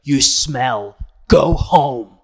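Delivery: loud sneering dismissal; backstage insult. Retold or imitated. Host insulting a writer backstage, loud mock contempt. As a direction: spit it at the writer. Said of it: "she's going in the back".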